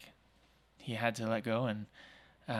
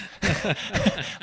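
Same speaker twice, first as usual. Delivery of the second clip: laugh; 8 semitones higher, 8 dB louder than usual. Real, not acted. Laughing at own joke.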